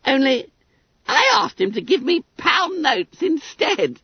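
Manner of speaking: high, squeaky schoolboy voice; self-satisfied Billy Bunter. A narrator's reading. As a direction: pipe it up high, full of importance. Bunter reveals his request.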